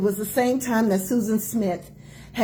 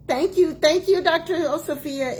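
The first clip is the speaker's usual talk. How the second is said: excited thanks; 7 semitones higher than usual. Real, not acted. Thanking a joining viewer.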